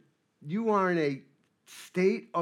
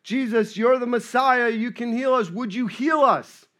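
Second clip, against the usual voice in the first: raised, louder plea acted out; imitated cry for help, higher-pitched; retold yell; 6 semitones higher, 7 dB louder than usual. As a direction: call out as if to someone far off. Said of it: "they yelled out and said".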